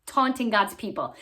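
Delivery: plain reading voice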